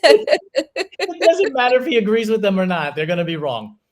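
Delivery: high laughing tease. Real, not acted. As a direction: laugh through the words. Laughing while teasing guest.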